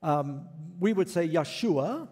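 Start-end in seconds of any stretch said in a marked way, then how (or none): 1.3-2.1 "Yeshua" said with an initial Y sound; Hebrew pronunciation. Shown as a demonstration. Preacher showing the congregation the Hebrew name, Hebrew pronunciation. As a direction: say "Yeshua" with stress on SHU. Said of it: "you're using the Hebrew pronunciation".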